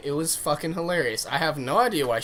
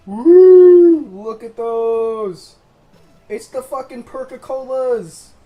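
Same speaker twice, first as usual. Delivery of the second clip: excited; 8 semitones higher, 15 dB louder, much slower than usual. Real, not acted. Showing off new shot glasses.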